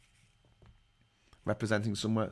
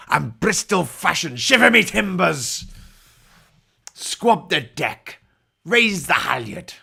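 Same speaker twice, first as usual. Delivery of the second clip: loud, higher pirate orders, slow and drawn out; pirate captain accent; 6 semitones higher, 7 dB louder, slower than usual. Acted. Pirate captain shouting orders at his crew, loud slow pirate accent. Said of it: "is the accent hurting your throat yet".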